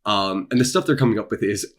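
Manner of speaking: usual speaking voice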